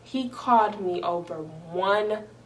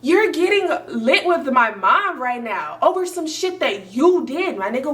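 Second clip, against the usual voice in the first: higher, louder mom telling him off; mom confronting; 5 semitones higher, 4 dB louder than usual. Retold or imitated. Mom scolding boyfriend for disrespect, loud. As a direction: scold him like an angry mother. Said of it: "And she's like".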